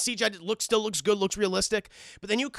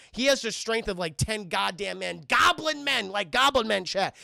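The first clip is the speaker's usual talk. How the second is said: ordinary talk, nothing marked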